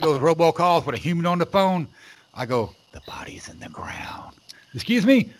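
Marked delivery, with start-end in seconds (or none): 2.9-4.4 slow, hushed whisper; creepy whisper. Acted. Creepy prank confession to robocall scammer. 4.7-5.3 lightly voiced high-pitched caller; a higher, questioning mock caller; puzzled caller. Voicing the puzzled caller's reply.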